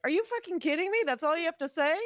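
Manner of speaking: high, incredulous outburst; mock outrage at a useless reply; mock outraged. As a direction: play it as exasperated disbelief. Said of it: "I would be like".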